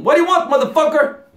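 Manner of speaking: loud, high and rude; aggressive street slang. Shown as a demonstration. Teacher joking about rude street English. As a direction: bark it like a tough local. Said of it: "Só falta um".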